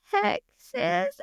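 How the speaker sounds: squeaky-high, sheepish confession; reluctant admission. Retold or imitated. Tourist admitting Texas to a Parisian, reluctant. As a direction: own up in a small high voice. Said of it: "Why do you say it like that".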